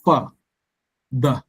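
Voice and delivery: "fa" and "the" as separate syllables; split into syllables. Shown as a demonstration. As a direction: break it in two: fa, pause, the. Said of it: "two syllables".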